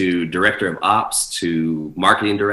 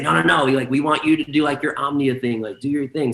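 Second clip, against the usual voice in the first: higher-pitched, pushy correction; mock insistent objection, pitched up; insistent club guy; 5 semitones higher than usual. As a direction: imitate a client cutting in with demands. Club guy correcting the DJ's choice.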